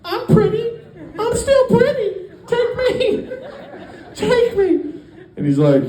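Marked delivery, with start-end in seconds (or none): 0.0-4.7 squeaky high offended woman voice; hammed-up high falsetto begging. Woman insisting the trafficker take her, high squeaky falsetto. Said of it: "And she's like".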